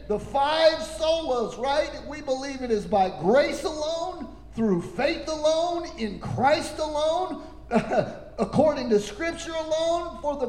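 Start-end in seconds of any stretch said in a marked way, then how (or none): none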